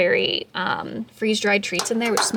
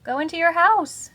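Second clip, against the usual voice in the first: high cooing pet talk; 7 semitones higher, 5 dB louder than usual. Real, not acted. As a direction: coax the little hamster in. Coaxing hamster into cage.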